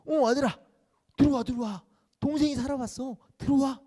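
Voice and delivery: high, loud eager call; father calling out. Acted. Father calls to sulking eldest son, loud and high. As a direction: call him in, arms wide open.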